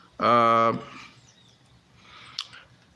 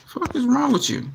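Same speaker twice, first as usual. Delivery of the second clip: sudden high angry outburst; pissed off; 13 semitones higher than usual. Real, not acted. Cursing at his camera that won't focus, real anger. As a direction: lash out at the broken camera. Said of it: "I am so pissed".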